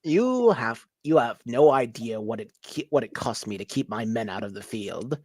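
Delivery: a petty warlord sneering at the visitors. Acted. GM voices warlord Goshnock.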